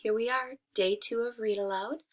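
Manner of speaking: bright, upbeat teacher hello; cheery class greeting. Acted. Teacher welcoming her class to read aloud, upbeat. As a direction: greet the kids warmly and brightly.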